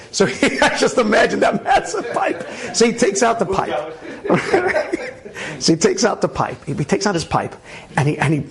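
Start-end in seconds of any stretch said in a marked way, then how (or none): 0.1-3.9 laughing. Real, not acted. Laughing at his own image. 4.0-5.5 high breathy laugh. Real, not acted. Cracks up at the pipe.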